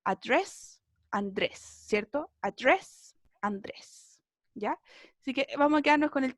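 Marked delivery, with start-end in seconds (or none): 0.0-0.8 "Address" softly in American English, rising; soft Americanized model of "Address"; gringo accent. Shown as a demonstration. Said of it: "Un poquito más gringa". 2.4-3.2 "Address" with an American English r, lightly rising; American a-DRESS, no n; gringo accent. Shown as a demonstration. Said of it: "Un poquito más gringa".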